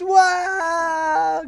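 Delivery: long, high-pitched whooping wow; drawn-out mock cheer of astonishment, soaring high; crowd's amazed outcry. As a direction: imitate a whole team erupting. Whole team's reaction acted.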